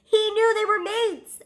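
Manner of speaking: high, excited exclamation; giddy high-pitched outburst. Real, not acted. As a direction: pitch it way up, gushing over the reveal. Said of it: "I'm so excited".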